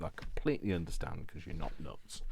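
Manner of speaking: ordinary talk, nothing marked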